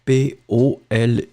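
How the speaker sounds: slow, spaced letters P O L I; teacher spells P O L I slowly for the class; spelled out letter by letter. Shown as a demonstration. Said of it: "poli is written like that".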